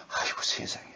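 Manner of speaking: breathy whispered sigh of dismay. Real, not acted. Preacher whispering his dismay to the congregation. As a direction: whisper it in disbelief.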